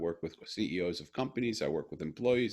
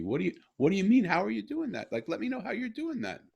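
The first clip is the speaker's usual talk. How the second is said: much higher, louder and fast, bursting; amazed excitement; 13 semitones higher, 4 dB louder than usual. Retold or imitated. Father begging his daughter to explain, excited high. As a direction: press her eagerly, beside yourself.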